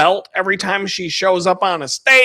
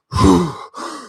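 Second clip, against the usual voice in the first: heavy breathing. Shown as a demonstration. Showing a childhood pass-out trick, heavy breathing. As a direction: demonstrate hard puffs of breath. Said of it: "like, breathe in and out, like, real heavily".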